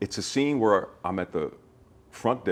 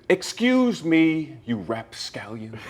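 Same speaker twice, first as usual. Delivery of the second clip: much higher, very slow, stretched-out excuse me; prim formal gentleman; 6 semitones higher, much slower than usual. Acted. Actor scolds Jamie in character, prim, high and very slow. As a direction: play an offended dignified gentleman, drawing out every word. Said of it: "And I immediately said".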